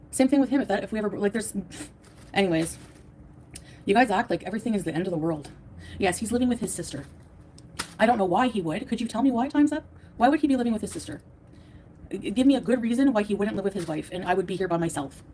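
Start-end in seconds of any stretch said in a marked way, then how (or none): none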